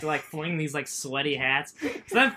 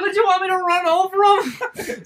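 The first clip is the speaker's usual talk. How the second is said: high, loud, incredulous retort; slow, high-pitched mock-indignant cry; exasperated protest; 13 semitones higher, 6 dB louder, slower than usual. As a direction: imitate yelling back at the boss, half laughing. Retold protest to screaming boss.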